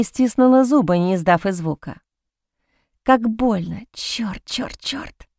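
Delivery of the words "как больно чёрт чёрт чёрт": pained curses, much softer; inner pained curse. A narrator's reading. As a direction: swear under the breath in pain, fading away. Inner pain under the knife. (тихо, сквозь боль, почти неслышно)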